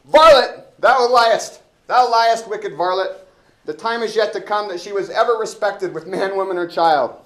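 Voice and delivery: very loud, high indignant shouting; hammed-up furious constable yelling; Elbow's outrage. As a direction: bellow at the rogue who insults your wife. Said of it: "I wanna be elbow".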